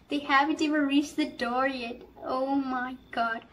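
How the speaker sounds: normal talking voice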